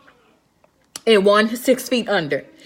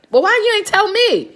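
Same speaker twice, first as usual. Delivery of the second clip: incredulous demand; 12 semitones higher, 4 dB louder than usual. Retold or imitated. Asking why nobody told her, high and loud. Acted high-pitched question, opening exclamation.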